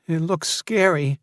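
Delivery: put-on high, quavering frightened girl; a scared child's tremble. A narrator's reading. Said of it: "said Erin in a quavering voice".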